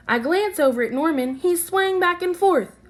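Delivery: put-on bright, lively reading to children; storybook read-aloud. A narrator's reading. Teacher reading a picture book to her class, lively. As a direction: read it like story time for little kids.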